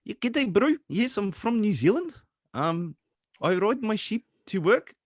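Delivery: high-pitched mock New Zealand accent. Showing friends a New Zealand impression, mock Kiwi accent. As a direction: put on a jokey, lilting high Kiwi accent. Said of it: "a perfect New Zealand accent".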